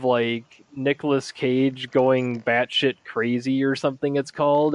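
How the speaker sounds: normal talking voice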